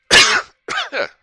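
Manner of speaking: loud choking cough on spit. Real, not acted. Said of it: "started choking to death with my own spit".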